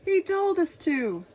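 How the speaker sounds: loud, high protest; cried. Acted. Knights blaming the king, loud cry. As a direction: cry out the blame. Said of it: "they cried".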